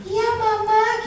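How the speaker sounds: put-on high, slow childish reply; obedient little child. Lecturer plays child obeying mother, sweetly compliant. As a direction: answer Mama in a drawn-out child voice. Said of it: "adik bersihin ya".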